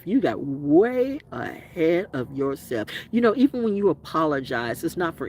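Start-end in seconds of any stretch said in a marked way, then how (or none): 0.0-2.9 drawn out slowly, each word spelled out; slow it right down for emphasis; slow, deliberate repeat. Shown as a demonstration. Telling off a narcissist, saying it again slowly.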